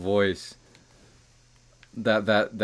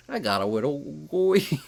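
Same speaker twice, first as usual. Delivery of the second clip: higher put-on nerdy voice; a slightly whiny nerd; 5 semitones higher than usual. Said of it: "I love doing my nerd voice".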